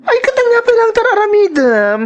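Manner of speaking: sassy scolding. Acted. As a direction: tease him like a fussy diva. Scolds him in high voice. (nangato a timek a panagungtet)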